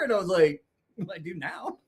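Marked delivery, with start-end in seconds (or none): 1.1-1.8 small, soft, higher voice; sheepish reply. Retold or imitated. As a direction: imitate a meek, caught-out admission. Sheepish reply to furious friend.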